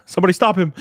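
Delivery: hammed-up urgent shout; mock alarm. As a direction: call for help in fun. Mock alarm at deleted old meme.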